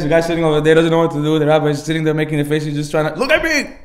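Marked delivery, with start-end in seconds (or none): 3.2-3.8 high-pitched imitated scream; screeched order, played up; mock screaming. Rabbi to the uncomfortable man, mock screaming. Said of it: "He's like, screaming".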